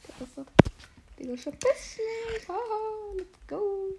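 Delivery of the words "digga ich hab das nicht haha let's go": high laughing. Real, not acted. Laughing during golem fight.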